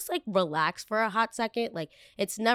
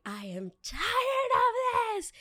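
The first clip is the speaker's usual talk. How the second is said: much higher, slow, drawn-out fed-up cry; slow, high mock outburst of someone at the end of her rope; exasperated outburst; much slower than usual. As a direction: stretch it out like you've finally snapped. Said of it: "I was just like".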